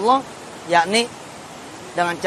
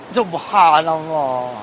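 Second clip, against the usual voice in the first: very slow, stretched-out Subhanallah; drawn-out awe; 3 semitones lower, much slower than usual. Acted. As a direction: savour the word in wonder. Marvelling at cheap bicycle.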